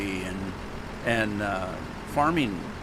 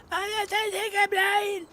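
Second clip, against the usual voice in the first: much higher, slow, squeezed out through a choking throat; choked, strangled croak; slower than usual. Retold or imitated. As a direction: retell it as if still choking on tea. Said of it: "I can't talk because I'm".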